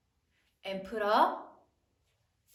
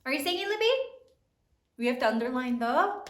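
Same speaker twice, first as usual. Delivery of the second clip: ordinary delivery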